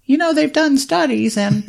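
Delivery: high falsetto wife impression, laughing. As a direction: squeak it out high like his wife. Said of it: "let me do my wife voice".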